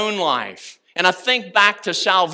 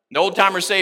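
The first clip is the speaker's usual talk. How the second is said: ordinary delivery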